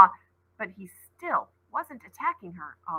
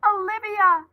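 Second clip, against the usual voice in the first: high, loud call of a name; calling cry; 12 semitones higher, 7 dB louder than usual. A narrator's reading. Mother calling Ollie once more, high and loud. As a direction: cry out from far off. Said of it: "cried her mom's voice once more".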